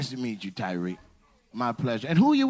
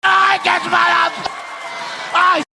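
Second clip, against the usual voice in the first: high, loud, drawn-out mock woman's voice; imitating a woman; 11 semitones higher, 5 dB louder, slower than usual. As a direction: shout it high, mimicking her. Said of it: "she's like".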